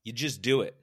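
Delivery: loud, punchy imitation of a motivator; motivational speaker's answer. Speaker answering a worker's problem, loud motivator voice. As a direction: fire back a simple command. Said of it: "He's like".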